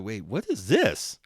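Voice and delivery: stunned double take while retelling; baffled reaction. Retold or imitated. Acting out his reaction while reading.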